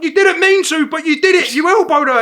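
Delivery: high, loud acted protest; heated retort. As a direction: argue back, retold with full voice. Retold argument back at drunk.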